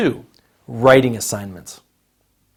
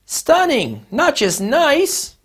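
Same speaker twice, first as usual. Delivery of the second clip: acted reply; 12 semitones higher, slower than usual. Acted reply in dialogue demo.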